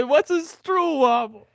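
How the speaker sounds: high and slow, puzzled; mock baffled old lady. Imitating his seatmate asking about the snack, high and slow. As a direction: ask it like it's a foreign object.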